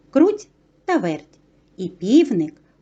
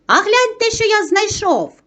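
excited call; 10 semitones higher than usual. A narrator's reading. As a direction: call them to come and look. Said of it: "став гукати Півник".

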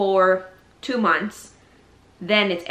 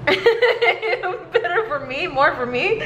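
slow, high, laughing gloat; gloating sing-song; 9 semitones higher, much slower than usual. Retold or imitated. Gloating over husband's leftovers.

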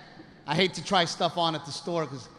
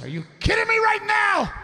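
furious yell; 3 dB louder than usual. Retold or imitated. Wife furious at husband.

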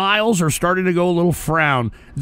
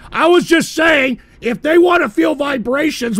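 indignant protest; 7 semitones higher, 4 dB louder than usual. Real, not acted. Indignantly defending himself to chat.

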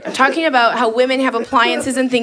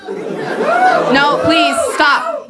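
very high squeaky no please stop; hammed-up high-pitched protest; mock bashful protest; much slower than usual. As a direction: squeal it like you're embarrassed. Fake protest at flattery.

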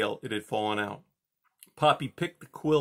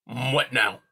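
irritated grumble; grumbled; 4 dB louder than usual. A narrator's reading. Porcupine turns back peevishly, grumbling. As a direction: let the annoyance show. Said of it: "he grumbled".

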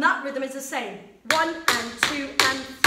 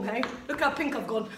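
normal talking voice

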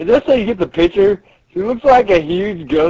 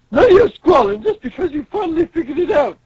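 higher-pitched dismissive scolding; scolding manager; 10 semitones higher, 3 dB louder than usual. Acted. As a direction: tell off the complainers. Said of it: "She's like".

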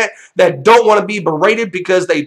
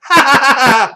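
short scoffing laugh, pitched up; mocking laugh; 6 semitones higher, 3 dB louder than usual. Real, not acted. Ranting vlogger to his viewers, a short mocking laugh. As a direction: laugh off your own sarcasm.

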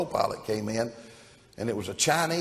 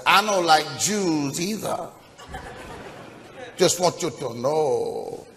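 slow character voice; 5 semitones higher, slower than usual. Acted. Co-pilot answers back to the pilot.

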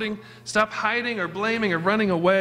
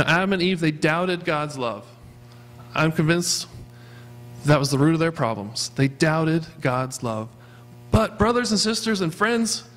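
ordinary talk, nothing marked

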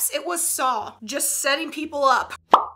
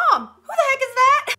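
high, loud startled demand; alarmed mom; 11 semitones higher, 6 dB louder than usual. Acted. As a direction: call out like a startled mother. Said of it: "My mom".

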